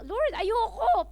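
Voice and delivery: slow, high-pitched mock refusal; acted reluctance. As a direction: balk at an order like a reluctant believer. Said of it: "ayaw din natin gawin".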